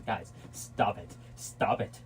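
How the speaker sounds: hushed slow warning; whispered stop it. Real, not acted. Hushing fighting kids.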